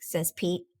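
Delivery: usual speaking voice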